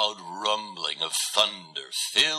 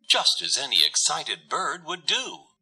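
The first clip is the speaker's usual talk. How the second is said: ordinary delivery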